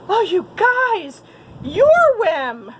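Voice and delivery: high, loud, slow relieved cry; relieved shout. A narrator's reading. Goose recognising his friends, shouting with relief. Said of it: "Goose shouted with relief".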